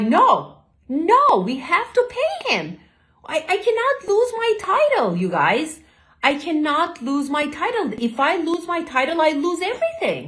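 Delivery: high, rushed, frantic pleading; begging them fast and high, close to tears; retold panicked plea. Panicked plea to family over title.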